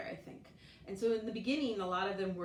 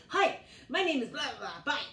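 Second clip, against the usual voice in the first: put-on excited kid; 7 semitones higher, 8 dB louder than usual. Kids rushing their English.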